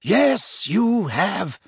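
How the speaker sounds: mildly firm, lifted "Yes" then settling; lightly acted firm mentor. A narrator's reading. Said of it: "said Dumbledore firmly".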